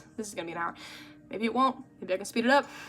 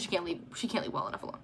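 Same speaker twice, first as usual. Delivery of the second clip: under-breath complaint; 4 semitones lower, 9 dB quieter, much faster than usual. Real, not acted. Exasperated about mom.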